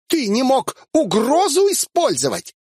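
angry blonde yelling curses; furious woman. Acted. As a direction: let her rage boil over. Angry woman reproaches hero.

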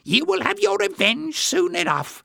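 very high-pitched little villain; malicious little man. A narrator's reading. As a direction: promise revenge in a high voice. Jinx speaking to his spider.

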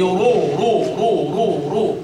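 رو said with a full, heavy ر. Shown as a demonstration. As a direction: repeat رو with a heavy ر. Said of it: "هذه راء مفخمة". (تفخيم الراء)

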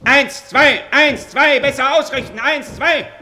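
loud high marching count; shouted drill count. Acted. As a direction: bark the count at the troops. Drills troops mid-conversation. (lautes Abzählen im Marschtakt)

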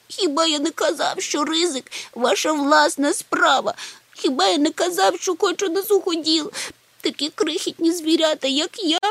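very high, plaintive lament; wailing. A narrator's reading. Little Chmykh lamenting that he wanted dry land, wailing. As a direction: wail like a tiny creature in distress. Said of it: "урвав його голосіння".

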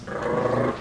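rrr as a long heavy trill; German-like strong r. Shown as a demonstration. Teacher likens it to Germans, rrr heavily trilled. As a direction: sustain a rolled rrr. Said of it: "Casi como los alemanes".

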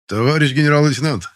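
loud, high parade report; formal report. Acted. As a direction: call it out to the general. Рапорт генералу на плацу. (громкий строевой доклад)

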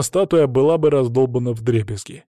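ordinary delivery, nothing marked

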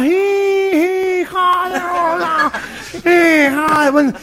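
grito nasal y alargado; multitud de gangosos. Acted. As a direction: corea lento, imitando a los gangosos. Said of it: "todos como cinco mil gangosos". (ovación gangosa arrastrada)

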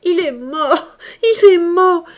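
high, loud laughing cry; frightening laugh. A narrator's reading. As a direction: laugh wildly through the grief. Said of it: "avec un éclat de rire effrayant".

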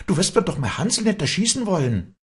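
very high, horrified protest; crying out. A narrator's reading. Walli protests to Josef for her bird's life, high cry. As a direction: protest in alarm. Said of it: "schrie Walli".

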